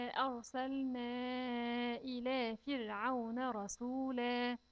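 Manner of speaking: slow, measured tajweed chant; measured recitation of the verse; slow Quran recitation. Shown as a demonstration. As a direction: recite it carefully for the children.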